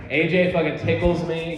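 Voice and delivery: normal talking voice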